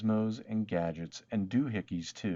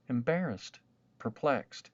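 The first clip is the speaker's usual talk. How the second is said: ordinary delivery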